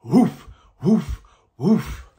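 whine-bark like his own dog. A narrator's reading. Reading to kids, woofing like his dog. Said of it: "And my dog barks".

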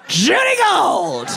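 high, drawn-out shout of a name; triumphant announcement. Retold or imitated. Agent pitching client to casting, triumphant drawn-out shout. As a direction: belt out the name like a grand reveal.

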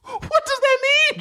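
high, cracking laughing question; laughing squeal. Real, not acted.

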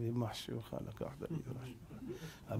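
barely audible low grumble; very soft, private resentment; secret inner curse. Acted. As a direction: curse him under your breath, as if only in your heart. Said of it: "בתוך הלב".

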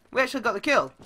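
ordinary delivery

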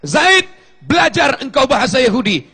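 high, loud ringing command; commanding call. Retold or imitated. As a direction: call Zaid with authority. Prophet commanding Zaid. (Nabi memerintah Zaid dengan lantang)

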